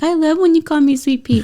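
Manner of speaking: put-on high, delighted child voice; sweet small-girl voice; little girl voice. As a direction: imitate a happy little daughter. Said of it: "she's like".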